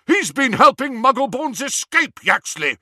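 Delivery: high, loud accusing shout. A narrator's reading. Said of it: "Harry shouted".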